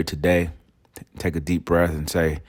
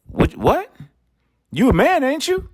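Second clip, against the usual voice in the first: much higher, mocking echo of the wife; mocking her back. Husband mimics the wife's challenge in a high mocking voice. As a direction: parrot her high and sarcastic. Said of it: "You're like".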